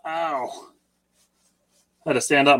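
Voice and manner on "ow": sharp yelp of pain from a cramp. Real, not acted. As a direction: wince out a quick ow. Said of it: "My leg cramped up".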